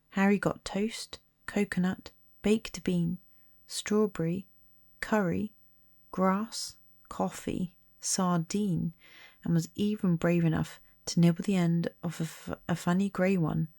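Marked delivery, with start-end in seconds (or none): none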